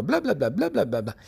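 parloteo incesante imitado, agudo a ratos; verrugas parlanchinas. Acted. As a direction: imitate endless chatter. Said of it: "hablan y hablan un montón". (imitar una cháchara sin parar)

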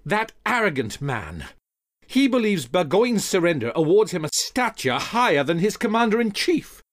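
loud, high outburst of indignation; heated rant, rising in pitch; angry venting. Acted. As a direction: vent fury about an arrogant superior. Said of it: "as Hamilton vented".

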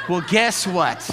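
ordinary talk, nothing marked

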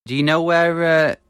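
normal talking voice

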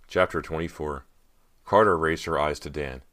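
usual speaking voice

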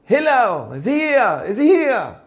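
high calling-out mock yell; retold screaming. Bettor hollering for the rabbi before Shabbat, mock scream. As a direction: call out insistently, imitating a pestering shouter. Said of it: "he screams at the top of his lungs".

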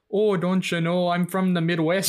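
slow, hammed-up Midwest accent; bad Midwestern accent. Imagining Fernando's accent next year, bad Midwestern accent. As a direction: do a really bad Midwestern drawl. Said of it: "That's a really bad Midwestern accent".

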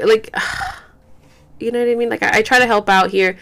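normal talking voice

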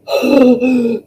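big acted gasp for air; heaving, exaggerated gasp; out-of-breath gasp. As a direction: gasp as if totally winded. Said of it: "I'm out of breath".

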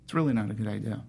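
low, quiet stern warning. Retold or imitated. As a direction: imitate a stern woman advising against it. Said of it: "she was so stern, she's like".

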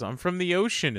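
high-pitched little fish voice; Nemo. Retold or imitated. Quoting Nemo's answer about home, high-pitched imitation. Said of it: "when they're asking Nemo where he's from, and he goes".

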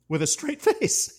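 breaking into a high laugh mid-phrase; can't keep it together; real laugh through the words. Real, not acted. Laughs while recounting joke.

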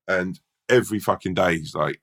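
ordinary talk, nothing marked

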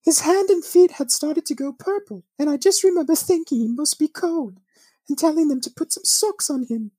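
put-on high British accent, fading to a whisper; British mum's voice sinking to a hush; British woman. Host reading the mother's memory, British accent into whisper. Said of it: "I gotta say it in a British accent".

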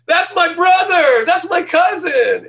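high, slow shouted announcement; excited family shout. Retold or imitated. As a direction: proudly yell to the neighbors. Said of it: "my family back home going".

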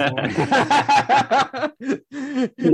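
high burst of laughter; bright real laugh. Real, not acted. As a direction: laugh out loud at the joke. Laughing at his own story.

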